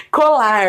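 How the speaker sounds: COLAR with the tongue curled up on the final R; retroflex R. Shown as a demonstration. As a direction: lengthen the curled R closing COLAR. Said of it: "chama esse movimento de retroflexo".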